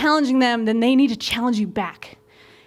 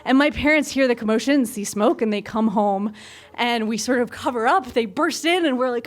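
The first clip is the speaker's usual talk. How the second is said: usual speaking voice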